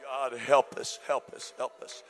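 breathy whispered plea; hushed whisper to God; whispered prayer. Real, not acted.

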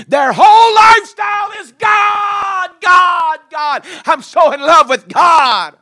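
high, loud, slow shouted praise; preaching climax. Acted. Preacher lifting the room, shouting high. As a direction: lift it up and holler.

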